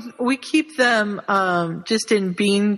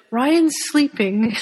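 slow, voiced like his wife; drawn-out mock report; 3 semitones higher, much slower than usual. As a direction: drawl it out slowly. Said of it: "she sent me a message and said".

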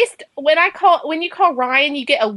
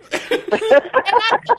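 laughing; 8 semitones higher, slower than usual. Real, not acted.

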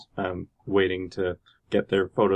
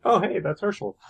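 excited recognition; 9 semitones higher than usual. Retold or imitated. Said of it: "other people in the line were like".

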